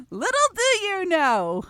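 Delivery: high, loud, slow taunt; gleeful teasing. Real, not acted. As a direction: tease them gleefully, stretched and high. Teasing listeners after a long laugh.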